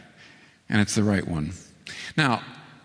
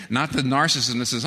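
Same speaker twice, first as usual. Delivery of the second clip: usual speaking voice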